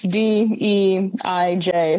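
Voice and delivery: D E I J slowly, letter by letter; spelled out. Shown as a demonstration. Guest clarifies the acronym, D E I J spelled out slowly. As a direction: give each letter of D E I J separately. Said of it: "that's why I pronounce it like that, but it's".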